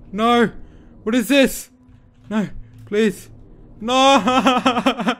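high, loud desperate shrieks; terrified screams. Real, not acted. Player cornered by the monster, begging and screaming. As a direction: scream and beg as it catches you.

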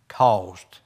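ordinary delivery

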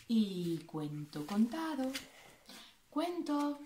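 slow, fading closing line; storybook ending. A narrator's reading. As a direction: close the tale softly. Closing formula of tale.

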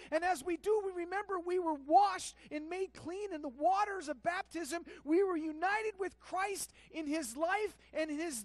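loud, high, driving sermon voice; thundering pulpit proclamation; full-force preaching. Acted. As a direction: preach it out with rising fire.

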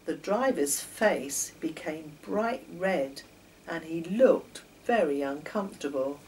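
ordinary delivery, nothing marked

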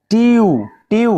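"টিইউ" উঁচু, জোরালো গলায় বানান করে "টিউ"; "টিউ"-তে লম্বা ইউ ধ্বনি টেনে বলা; ইউ উচ্চারণ দেখানো. Shown as a demonstration. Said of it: "ইউ উচ্চারণ করতে হচ্ছে". (শিক্ষকের মতো "টিইউ, টিউ" জোরে ভেঙে বলা)

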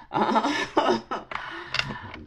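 a quiet breathy laugh; soft laugh. Real, not acted. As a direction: laugh it off softly. Laughs after joke.

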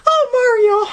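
high princess voice. Acted. Voicing Peach with Mario.

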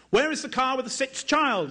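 high, urgent shout down the line; narrated shouting. A narrator's reading. Soldier shouting down the car queue, a mild shout. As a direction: call it out searching for the child. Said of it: "the soldier kept shouting in English".

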